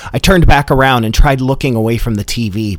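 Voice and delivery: ordinary talk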